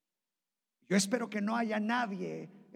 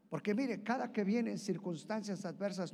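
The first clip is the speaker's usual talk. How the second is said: ordinary talk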